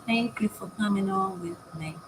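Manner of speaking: usual speaking voice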